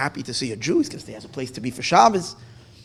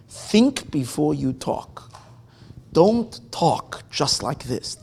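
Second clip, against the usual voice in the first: slow warning; much slower than usual. Acted. Master's warning quoted.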